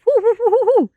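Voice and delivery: high, loud whoo sounds; imitated whoosh. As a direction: mimic glasses flying, whoo whoo. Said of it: "they would just go whoo".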